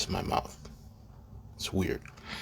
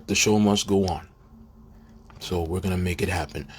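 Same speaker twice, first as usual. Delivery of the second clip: ordinary talk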